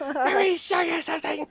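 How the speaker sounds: high, loud manic comic voice; Fire Marshal Bill. Acted. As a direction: squeal it like a frantic safety nut. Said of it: "was that a Fire Marshal Bill impression?".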